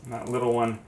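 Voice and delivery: ordinary delivery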